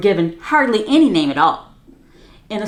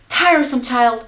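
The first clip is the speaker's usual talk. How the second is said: impatient; 10 semitones higher, 5 dB louder than usual. Acted. Impatient mother.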